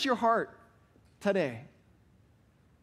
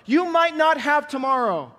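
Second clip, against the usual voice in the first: higher and louder, pressing the point; urgent warning; 6 semitones higher, 4 dB louder than usual. Real, not acted. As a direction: warn them there may be no tomorrow. Preacher warns with urgency.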